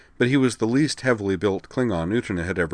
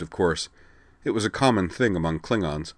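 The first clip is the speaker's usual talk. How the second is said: usual speaking voice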